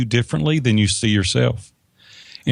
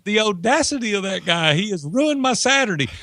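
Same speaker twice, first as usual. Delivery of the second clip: indignant; 8 semitones higher than usual. Retold or imitated. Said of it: "I was so mad".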